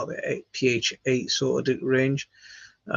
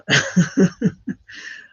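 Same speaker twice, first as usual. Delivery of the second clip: amused laugh; 7 semitones higher, 5 dB louder than usual. Real, not acted. Reacting to chat comment.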